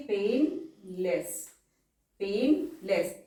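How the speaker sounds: Painless drawn out very slowly; slow and syllabled. Shown as a demonstration.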